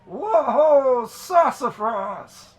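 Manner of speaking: high, loud, drawn-out holler; big character yell, stretched out at the end. Acted. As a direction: holler "whoa" to a team from afar. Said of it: "a voice yelling out".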